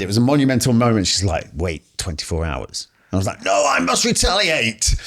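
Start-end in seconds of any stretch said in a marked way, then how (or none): 1.5-2.9 lower, softer, slow and steadying; calm him down gently; calm reassurance. Retold or imitated. Wife calming him down. 3.4-4.9 high, slow hammed-up vow; mock dramatic defiance.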